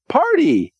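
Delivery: very high, thrilled "Party."; gleeful party squeal. Retold or imitated. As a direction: shriek it like a happy kid. Timmy misspells nap.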